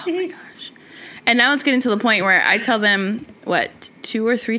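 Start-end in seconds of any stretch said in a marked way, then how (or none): 0.1-0.7 whisper. Real, not acted. Reacting to her shaking.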